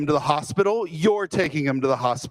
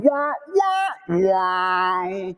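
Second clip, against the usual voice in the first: mock western whistle; 3 semitones higher, much slower than usual. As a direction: hum the standoff theme like an old Western. Imitated Western standoff music.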